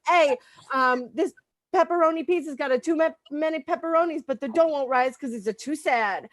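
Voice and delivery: brash New Yorker griping about pizza; New York accent. Acted. Comic doing a New York accent about sad pizza dough. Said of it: "I have to do an accent now".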